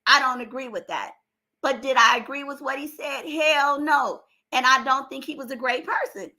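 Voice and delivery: ordinary talk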